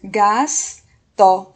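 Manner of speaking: GASTO as a loud GAS, pause, TO; two emphatic beats on GASTO; syllable by syllable. Shown as a demonstration. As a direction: hold the S in GASTO, then add TO separately. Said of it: "ela vai ser".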